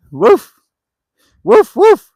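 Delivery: high mock woofing; imitated dog bark.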